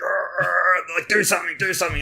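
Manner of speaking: high, strained annoyed grumble; hammed-up annoyance; mock annoyed crew. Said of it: "they were getting really annoyed".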